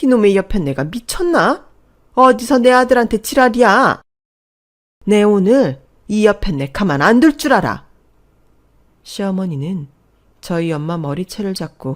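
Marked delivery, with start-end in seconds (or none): 0.0-7.9 high, very loud, vicious yelling; screaming rage. A narrator's reading. Mother-in-law shakes the other mother by the hair, screaming. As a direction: shriek threats at the rival mother. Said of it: "악을 써댔어요".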